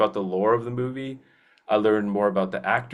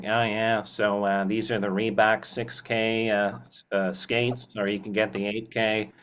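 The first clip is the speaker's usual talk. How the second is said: imitated sports store owner pitching gear; Gary. Store owner telling a customer about Reebok skates, imitated voice. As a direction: put on the store owner's voice, unhurried and a little halting. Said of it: "Gary talked like this".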